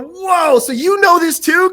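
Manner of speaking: high, excited disbelief; amazed reaction. Retold or imitated. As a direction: stretch the whoa in astonishment. Voicing his amazed inner reaction.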